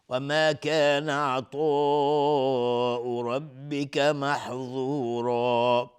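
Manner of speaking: slow melodic tajwid chant; Quran recitation. Acted. Teacher chanting the verse for students to follow. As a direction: recite the verse in measured tartil.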